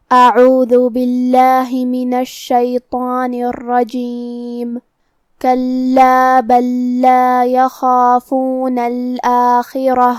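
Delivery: slow, drawn-out tajweed chanting; measured Quranic tilawat with long vowels; Quran recitation. Acted. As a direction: recite the verse slowly and melodically. Teacher recites Quran verse.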